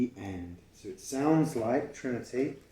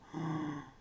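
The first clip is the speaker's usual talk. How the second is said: gasp; 4 semitones higher, 4 dB quieter than usual. Acted. Reading aloud, gasp written.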